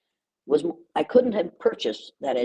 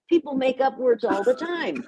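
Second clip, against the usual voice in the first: laughing; 7 semitones higher than usual. Real, not acted. Laughing about made-up words.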